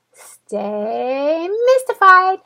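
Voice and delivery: slow dreamy outro catchphrase; signature sign-off. Shown as a demonstration. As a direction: stretch out the catchphrase like a show ending. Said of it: "push the button in the right way".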